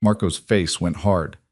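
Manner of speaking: plain reading voice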